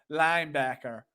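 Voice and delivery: badly faked Southern linebacker; Southern accent. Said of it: "do it with a southern ac".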